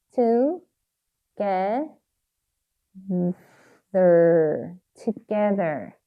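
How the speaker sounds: "Together" in syllables with long gaps; sounding out while writing. Shown as a demonstration. Teacher writing "Together", sounding it out very slowly. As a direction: stretch "Together" out as each part is written. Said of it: "발음하시면서 꼭 쓰셔야 돼요".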